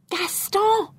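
high-pitched, anxious exclamation; exclaim, thrilled and frightened at once; excitement and fear. A narrator's reading. Calling partner after finding the ring, excited and fearful. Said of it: "with excitement and fear mingling in my voice".

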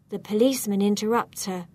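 plain reading voice

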